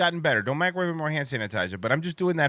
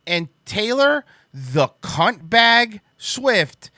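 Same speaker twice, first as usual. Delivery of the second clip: loud, higher outraged ranting; angry rant; 6 semitones higher, 4 dB louder than usual. Real, not acted. Defending Thomas from the singer, a loud, high outraged rant.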